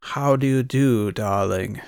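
slow, pretentious put-on posh accent; mock old-movie posh accent, drawn out. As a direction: put on airs in a fancy accent, as if talking to the Queen. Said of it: "Pretentious, like".

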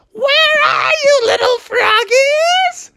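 very high, slow drawn-out taunt; menacing goblin singsong. Acted. Anne bursts in seeking frogs, high slow taunt. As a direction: stretch it out like a hunting villainess.

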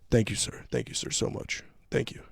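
soft, polite thanks; super nice. Retold or imitated. Officer to passenger helping old man, softly super nice. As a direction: thank him quietly and deferentially. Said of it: "then he was super nice".

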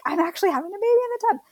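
retold amazement. Mother recalling her thought in the birth tub, amazed.

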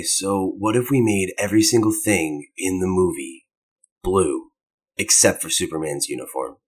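low hushed brooding drama voice; whisper yelling. Pretend. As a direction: murmur it darkly like a grim movie. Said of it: "let's all talk like we're in a Zack Snyder scene".

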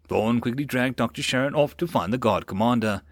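ordinary delivery, nothing marked

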